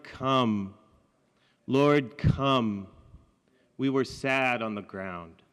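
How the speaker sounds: slow, weighty reading of a prayer poem. Acted. Poet reading a friend's poem to the audience, solemn. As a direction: read it like a broken prayer, pausing long.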